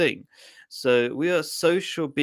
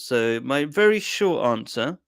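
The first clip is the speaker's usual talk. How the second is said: normal talking voice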